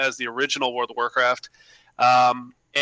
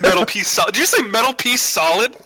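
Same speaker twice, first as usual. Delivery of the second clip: laughing; 7 semitones higher, 5 dB louder than usual. Real, not acted. Teasing misheard title, laughing.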